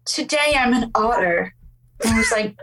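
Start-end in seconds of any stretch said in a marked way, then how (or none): 0.0-1.5 a hint of put-on British in a child's greeting; vaguely British accent. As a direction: play the little sea creature, faintly British. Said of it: "it's, like, a vaguely British accent".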